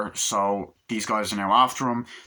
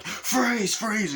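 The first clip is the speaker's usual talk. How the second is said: much higher mock police shout; cops yelling; 12 semitones higher than usual. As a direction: order a suspect to stop. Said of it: "they're like".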